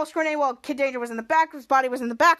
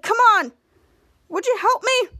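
high, loud, impatient plea; acted exasperated shout; calling for help; 7 semitones higher, 5 dB louder than usual. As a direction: call out, urging them to act. Stranded man calls for help.

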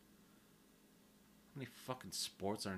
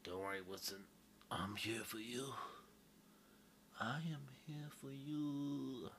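dying last words; 6 semitones higher, 3 dB quieter than usual. Pretend. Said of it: "when I take my last breath".

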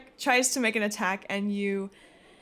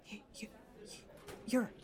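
scared whisper; 15 dB quieter, faster than usual. Acted. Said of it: "And he goes".

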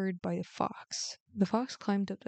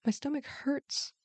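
high, put-on complaint of pain; mock-pained, pitched up; feigned pain; 7 semitones higher than usual. A narrator's reading. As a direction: pretend your belly is aching. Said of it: "pretended to be in pain".

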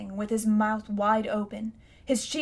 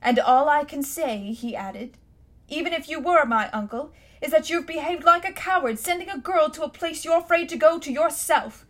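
louder, higher, furious accusation; angry boy; 5 semitones higher, 5 dB louder than usual. Acted. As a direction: call your uncle a coward. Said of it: "His cheeks were flaming with anger now".

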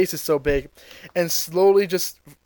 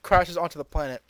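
ordinary delivery

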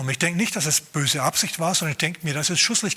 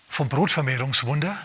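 ordinary talk

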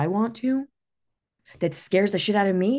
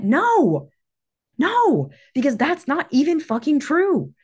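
emphatic rejection; 6 semitones higher, 4 dB louder, slower than usual. Real, not acted. Rejects the fake mentor.

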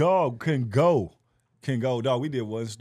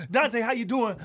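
high, louder crowd greeting; imitating hype comic; 10 semitones higher, 5 dB louder than usual. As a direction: greet a guest like an overeager stage comic. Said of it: "everybody come on stage and be like".

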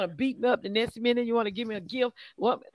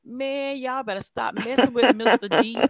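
joking warning through laughter; laughing; 6 dB louder than usual. Real, not acted. Minister jokingly warns y'all about Mister D, laughing. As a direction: break up laughing as you warn.

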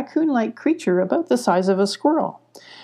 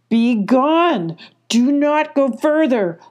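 high, loud threat from the bushes; threatening shout; 6 semitones higher, 5 dB louder, slower than usual. A narrator's reading. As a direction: warn them off, loud and high. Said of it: "the voice threatened".

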